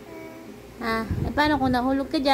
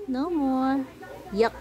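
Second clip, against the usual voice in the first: usual speaking voice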